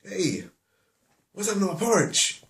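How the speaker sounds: high-pitched, hammed-up indignant bear; Daddy Bear outraged. Daddy Bear finding his porridge touched, hammed-up high outrage. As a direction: cry out in mock outrage, voice leaping up. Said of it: "if he did speak English".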